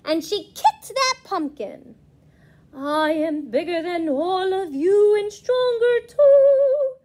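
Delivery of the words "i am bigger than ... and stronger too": big booming boast, half sung; boastful mummy. A narrator's reading. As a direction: brag like a proud mummy. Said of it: "boasted the mummy".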